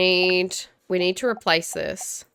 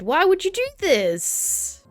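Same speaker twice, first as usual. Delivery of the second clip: much higher, slow, disbelieving whine; exasperated; 11 semitones higher, much slower than usual. Real, not acted. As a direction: plead with the broken detector in disbelief. Exasperated at broken detector.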